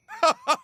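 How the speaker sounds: gloating laugh. Acted. Villain gloating over the killing.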